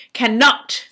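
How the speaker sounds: cannot with a rising tune, the British way; cannot, can stressed, British-style. Retold or imitated. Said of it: "Pronounce it the British way".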